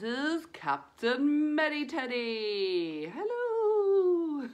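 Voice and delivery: high, slow squeaky teddy puppet voice. Pretend. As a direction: play the cheerful teddy saying hello. Teacher voices a puppet friend.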